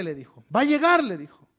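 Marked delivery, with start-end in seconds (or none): 0.5-1.0 high, loud mock order; acted insistence. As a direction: imitate someone who won't take no. Woman insists that he come.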